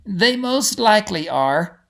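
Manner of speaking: steady the group with calm assurance; a lightly voiced reassuring guide; kindly guide Igri, calm. A narrator's reading. Igri admits a worry.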